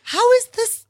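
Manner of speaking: high, loud gasp of disbelief; stunned disbelief. Retold or imitated. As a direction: blurt it out in shock. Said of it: "I was just like".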